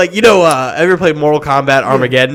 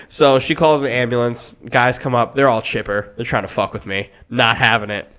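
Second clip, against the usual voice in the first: ordinary talk, nothing marked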